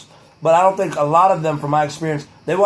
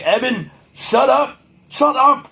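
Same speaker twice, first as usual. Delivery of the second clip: "shut up" yelled higher and louder; retold yell; 7 semitones higher, 3 dB louder, slower than usual. Teacher walking him to the principal's office yells at him, loud and high. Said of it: "Like, he yelled at me".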